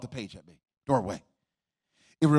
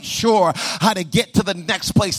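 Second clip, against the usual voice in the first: rising, louder preaching over music; full-force preaching; 4 semitones higher, 4 dB louder than usual. Acted. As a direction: preach it home with mounting intensity.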